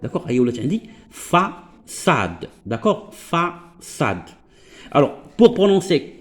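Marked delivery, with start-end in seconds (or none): 1.1-2.5 "فاساد" slowly split, with an s. Shown as a demonstration. Teacher reading the word after adding cedilla, slow soft s. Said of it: "انا الا بغيت نطقها كوم سا". 3.1-4.2 "فاساد" softly drawn out in two syllables; slow, softer "فاساد" with an s. Shown as a demonstration. Teacher repeating the corrected word, slow soft s. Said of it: "انا الا بغيت نطقها كوم سا".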